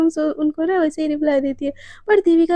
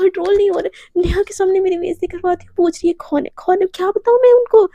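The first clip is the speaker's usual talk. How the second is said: mocking echo building to higher, louder complaint; acted indignant outburst; 4 semitones higher, 4 dB louder than usual. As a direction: throw their words back, increasingly worked up. Mocks partner, complains of embarrassment.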